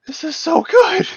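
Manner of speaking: put-on high delighted girl voice; girl's voice. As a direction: squeal it like a thrilled girl.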